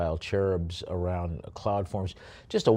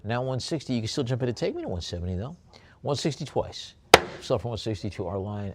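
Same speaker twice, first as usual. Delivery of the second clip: auctioneer calling bids; 4 semitones higher, faster than usual. Acted. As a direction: coax one more bid.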